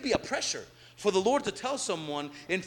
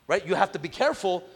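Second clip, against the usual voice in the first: ordinary delivery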